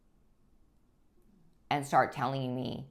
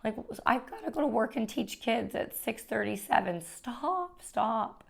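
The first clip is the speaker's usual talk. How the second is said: higher, pleading voice, imitating herself in tears; retold tearful pleading; 9 semitones higher than usual. Wife retelling how she begged her husband at night, mild tearful plea. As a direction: beg him to stop, replaying it in a raised, teary voice. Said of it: "and I'm crying".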